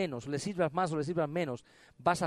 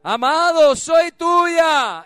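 high, loud calling of the bride; bride's cry; 12 semitones higher, 14 dB louder, much slower than usual. Pretend. Bride's voice quoted in sermon. (la amada que clama en voz alta y aguda)

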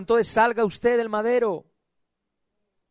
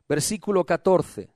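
normal talking voice

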